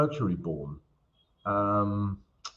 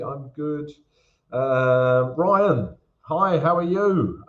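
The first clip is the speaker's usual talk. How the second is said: ordinary talk, nothing marked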